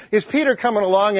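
ordinary delivery

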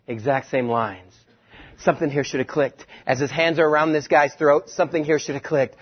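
normal talking voice

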